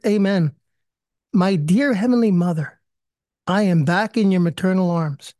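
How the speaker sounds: ordinary talk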